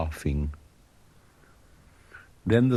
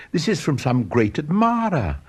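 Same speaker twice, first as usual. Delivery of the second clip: excited; 6 semitones higher, 3 dB louder than usual. A narrator's reading. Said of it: "he cried".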